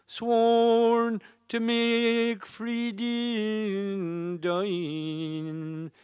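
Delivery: ordinary talk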